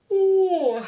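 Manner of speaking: big high yawning ooh; sleepy yawn on camera; loud yawn. Real, not acted. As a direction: yawn out loud mid sentence. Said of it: "I'm sorry I yawned".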